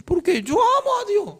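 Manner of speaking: high, lightly mocking pious voice; mock self-satisfied believer. Preacher mocking a man who thinks loving God is easy, high mock piety. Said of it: "Según este hombre".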